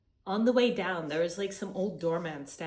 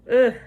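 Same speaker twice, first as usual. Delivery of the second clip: loud exhausted groan; groan from the heat; 5 semitones higher, 15 dB louder than usual. Real, not acted. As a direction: groan like you're melting in the sun. Sweaty after the uphill walk.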